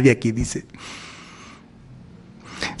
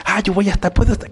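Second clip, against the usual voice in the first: eager promise; 7 semitones higher, faster than usual. Acted. Neighbour promising to attend.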